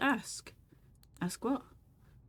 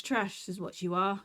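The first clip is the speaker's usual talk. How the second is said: ordinary delivery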